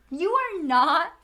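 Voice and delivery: high, drawn-out mock disbelief; incredulous. Retelling her reply to fake-Scot coworker, incredulous. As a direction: call out the faker, stretching the words.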